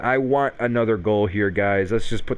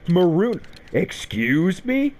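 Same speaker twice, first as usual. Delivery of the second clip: incredulous; 8 semitones higher, slower than usual. Real, not acted. Reacting to player's anger.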